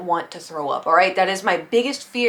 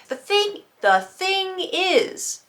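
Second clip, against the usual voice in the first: high emphatic drawl; 10 semitones higher, much slower than usual. Real, not acted. Emphatic aside.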